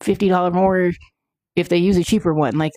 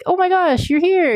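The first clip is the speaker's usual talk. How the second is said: much higher, delighted squeal of welcome; thrilled greeting; 12 semitones higher than usual. Retold or imitated. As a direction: act overjoyed to see her. Mimics her own excited greeting.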